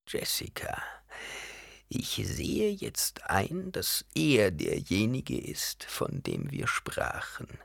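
heiseres, gehauchtes Greisinnenflüstern; leise, langsame alte Hexe; alte Frau flüsternd. A narrator's reading. Alte Ehrwürdige Mutter spricht. (alte Ehrwürdige Mutter, geflüstert)

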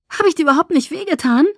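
high, loud, fast question; heated, rapid-fire indignation; furious and annoyed. A narrator's reading. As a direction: demand it in irritated disbelief. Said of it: "fragte ich wütend und verärgert".

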